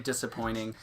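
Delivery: usual speaking voice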